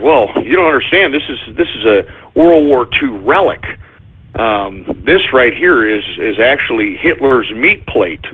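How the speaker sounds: a hint of a pushy dealer; seller's voice. Seller voiced in retold story.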